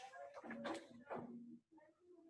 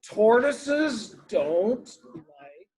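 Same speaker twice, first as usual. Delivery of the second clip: slow, drawn-out grumpy complaint; grumpy tortoise; 8 semitones higher, much slower than usual. A narrator's reading. Woken Tortoise complains to Rabbit, slow and grumpy. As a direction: stretch each word in a higher, grouchy whine. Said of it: "It's a grumpy tortoise".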